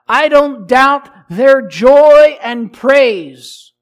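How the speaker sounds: loud, high, ringing declaration; booming pulpit emphasis, each phrase driven home; impassioned preaching. Real, not acted. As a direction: preach it at full force, stretching key words.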